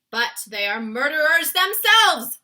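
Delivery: high, very loud, slow protest; cried out. Acted. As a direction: shout back at the Queen. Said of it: "cried the head of the army".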